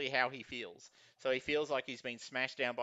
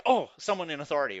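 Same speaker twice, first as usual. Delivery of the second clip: quick, louder bystander reaction; startled bystanders; 5 semitones higher, 9 dB louder, faster than usual. Acted. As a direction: react like a crowd noticing who's in charge. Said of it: "people are like".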